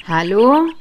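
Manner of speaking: loud, rising questioning call. A narrator's reading. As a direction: call into an empty house to see who answers. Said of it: "rief ich". (fragend laut rufen)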